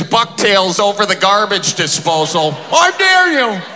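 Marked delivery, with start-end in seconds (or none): 2.7-3.6 high screechy dare, mimicking grandma; screamed threat. Grandma holding his bucktails over the disposal, high screamed dare. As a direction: shriek it as an enraged grandma's ultimatum. Grandma's hostage standoff, acted.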